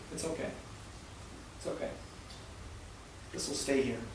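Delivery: soft, hushed reassurance; quietly calm him, barely above a breath. Retold or imitated. Manager quietly comforting a crying employee, soft and hushed. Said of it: "just quietly says".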